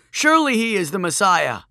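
high, loud acclaiming cry; shout. A narrator's reading. Woman in the crowd acclaiming Joshua, shouting. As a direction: call it out from a roaring crowd. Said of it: "A woman shouted".

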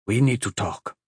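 usual speaking voice

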